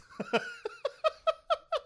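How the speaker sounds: a shrill burst of laughter; high shrieking laugh. Real, not acted. Laughing at the prank on the shy kid. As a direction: let the laugh fly up high.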